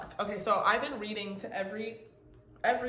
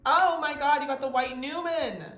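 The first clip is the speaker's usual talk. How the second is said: loud, high, excited exclamation; thrilled outburst; 6 semitones higher, 9 dB louder than usual. Real, not acted. Reader revealing a very special card to the group, thrilled. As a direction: shout it out in amazed delight.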